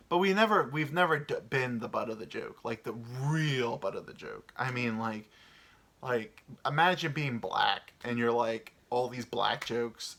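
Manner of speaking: ordinary delivery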